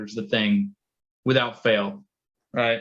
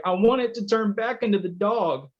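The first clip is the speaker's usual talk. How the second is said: put-on a much higher little-girl voice; daughter's voice; 9 semitones higher than usual. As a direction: imitate a small daughter pleading. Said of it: "And she's just like".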